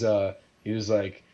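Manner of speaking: usual speaking voice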